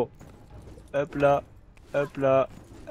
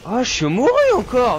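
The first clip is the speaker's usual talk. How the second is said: dramatic wail; 11 semitones higher, 9 dB louder than usual. Real, not acted. Dying in co-op game.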